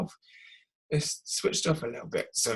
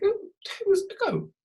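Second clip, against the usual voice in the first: surprised. Retold or imitated. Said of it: "I was like".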